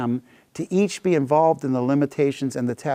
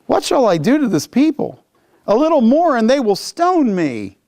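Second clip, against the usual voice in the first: high, raised plea, slowing at the end; desperate Moses; 7 semitones higher than usual. Acted. Moses crying out to the Lord, high and desperate. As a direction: cry out for help, cornered. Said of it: "Moses cried out to the Lord, saying".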